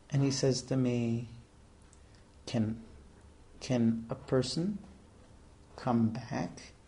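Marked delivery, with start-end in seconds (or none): 2.5-6.7 low, soft, halting question; deep voice. Retold or imitated. Sad-eyed Russian stranger questions the rabbi, deep hushed voice. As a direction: lightly imitate a deep-voiced stranger, quietly. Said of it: "he has this deep voice".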